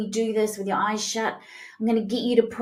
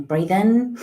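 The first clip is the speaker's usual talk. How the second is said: ordinary talk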